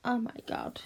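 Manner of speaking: ordinary delivery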